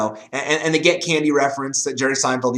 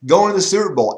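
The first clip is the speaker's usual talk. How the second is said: higher-pitched, mildly hysterical fan chatter; mock freaking out; 6 semitones higher than usual. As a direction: imitate excited fans online. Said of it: "Twitter was just going freaky".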